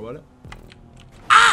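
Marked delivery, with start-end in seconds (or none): 1.3-1.5 short, loud, high yelp; sudden sharp "ah!"; startled shriek. Real, not acted. Player startled mid-race, startled shriek.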